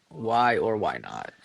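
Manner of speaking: ordinary delivery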